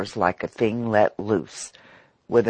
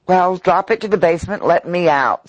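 quick, sharp order, pushed a bit louder; 6 semitones higher, 5 dB louder than usual. A narrator's reading. Lobbyist sharply ordering the boy to drop the car. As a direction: snap it out to be let off the lift. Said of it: "said Mister Ludlow sharply".

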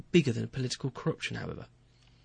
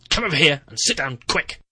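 sharp, much louder command; snapped order; 3 semitones higher, 10 dB louder than usual. Acted. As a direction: bark the order to make him jump. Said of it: "I snapped an order at him".

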